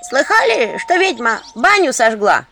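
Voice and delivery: high, loud village gossip calling out; loud high gossiping woman. Acted. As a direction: broadcast the rumour down the street. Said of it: "громкий женский голос на улице".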